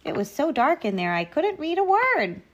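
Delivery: put-on high old-lady voice; querulous high-pitched grandmother; complaining Granny. A narrator's reading. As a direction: grumble like a storybook granny. Granny complains after rescue.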